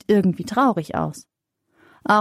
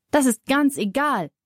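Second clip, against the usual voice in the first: mildly annoyed mother, higher pitch; 5 semitones higher than usual. A narrator's reading. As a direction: cut off the excuse with mild annoyance. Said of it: "sagt Mama ärgerlich". (leicht ärgerlich)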